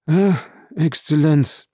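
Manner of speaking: drawn-out sigh of distress; anguished lament. A narrator's reading. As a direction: wail it wearily to your master. Anguished steward laments to master.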